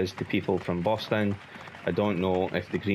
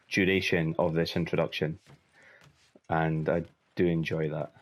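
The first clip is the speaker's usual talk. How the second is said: ordinary talk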